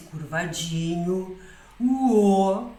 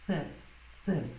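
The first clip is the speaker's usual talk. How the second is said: tongue between teeth, th breathed out; th demo; interdental th; 8 dB quieter than usual. Shown as a demonstration. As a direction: let air out over the tongue. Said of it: "a língua fica entre os dentes".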